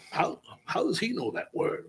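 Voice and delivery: whispered wondering to himself; puzzled whisper. Retold or imitated. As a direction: hush it like a secret thought. Retelling his own inner puzzled thought.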